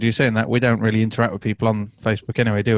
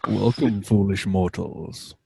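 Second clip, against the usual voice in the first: slow, low spooky welcome; dark lord; 3 semitones lower, 3 dB quieter, slower than usual. Pretend. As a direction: greet them like a haunted host. Spooky greeting to joining guest.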